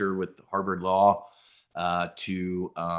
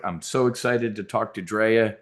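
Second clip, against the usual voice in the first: usual speaking voice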